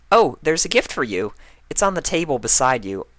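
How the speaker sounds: hint at a secret gift; teasing Twilight. Acted. Twilight hinting to Spike about the gift, playful.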